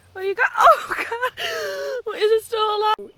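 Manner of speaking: high, loud, horrified cry; real alarm. Real, not acted. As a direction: cry out at what the cat caught. Discovers cat has live prey.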